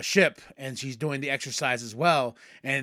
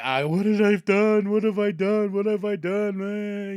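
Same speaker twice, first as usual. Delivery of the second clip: higher, louder mock despair; anguished regret; 6 semitones higher, 5 dB louder than usual. Brother seeing his burnt village, hammed-up anguish. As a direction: wail over what he caused. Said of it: "The brother's like".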